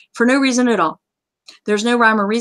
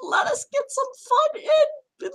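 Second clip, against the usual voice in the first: very high, squeaky plea; mock pleading; 5 dB quieter than usual. Host begging players for some fun, mock whiny pleading. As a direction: beg pitifully, hammed up.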